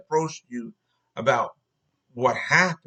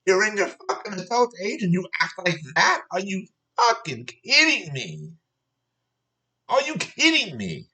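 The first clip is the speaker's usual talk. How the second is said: higher-pitched, exasperated disbelief; incredulous scolding; 6 semitones higher than usual. Real, not acted. Scolding a worker in adult age who cried, incredulous.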